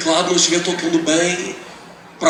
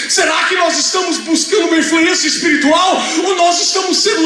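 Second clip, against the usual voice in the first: pulpit questions building higher and stronger; impassioned preaching; 8 semitones higher, 3 dB louder than usual. Real, not acted. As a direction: challenge the congregation, rising in force.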